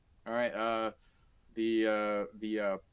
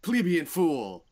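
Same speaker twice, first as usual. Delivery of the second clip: slow, louder, looming over a peon; booming arrogant overlord; 4 semitones higher, 6 dB louder, slower than usual. Pretend. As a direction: sneer it down like a demon lord. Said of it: "stuff like that".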